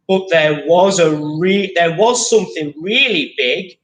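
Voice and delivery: lively picture-book read-aloud; story reading to children. Acted. Teacher reads the picture book to pupils, lively read-aloud. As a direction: read the rhyming story to the class.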